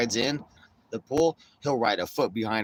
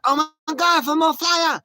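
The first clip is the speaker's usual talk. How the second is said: mock screech, very high and loud; exaggerated high-pitched, outraged mimicry; triggered outburst; 10 dB louder than usual. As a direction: imitate an outraged woman shrieking in accusation. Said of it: "she's all triggered by".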